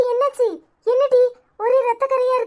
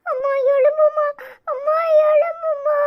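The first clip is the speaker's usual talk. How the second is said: put-on crying child; 5 semitones higher than usual. Children beg unconscious mother.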